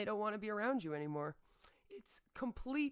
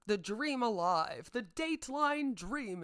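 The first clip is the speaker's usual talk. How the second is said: sarcastic; 4 semitones higher, 6 dB louder, slower than usual. Retold or imitated. Sarcastic mock.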